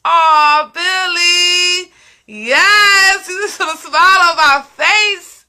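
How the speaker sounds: moved almost to crying by a kind viewer; emotional thanks to Billy, on the edge of tears; touched, near tears. Real, not acted. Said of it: "you gonna make me cry".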